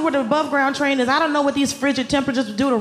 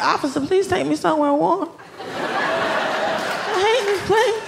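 pleading to officer; 6 semitones higher, much slower than usual. Pretend. Begging the cops.

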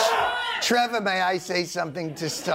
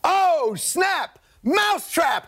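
high, loud victory yells, chanting; triumphant shouting; 13 semitones higher, 3 dB louder, much slower than usual. Acted. [name] crowing over Trevor after checkmate, gleeful shouts. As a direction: cheer like you just won the Cold War.

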